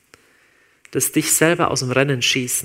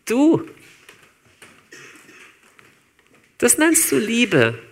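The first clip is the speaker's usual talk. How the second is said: higher, slow scornful sneer; mocking accusing voice; 5 semitones higher, slower than usual. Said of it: "kommt ja auch eine Stimme und die sagt". (höhnische Anklägerstimme, hoch und gedehnt)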